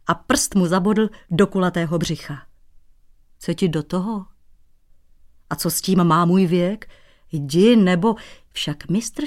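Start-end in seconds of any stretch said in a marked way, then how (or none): none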